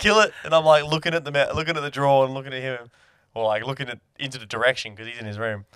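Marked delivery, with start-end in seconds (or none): 0.0-0.3 high, loud command; retold shouted order. Flatmate yelling back an order, high loud command. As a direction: bark the order from the other room.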